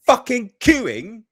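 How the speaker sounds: slowed, mocking outburst; scornful shout. Real, not acted. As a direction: spit it out in outrage. Scornful climax of rant.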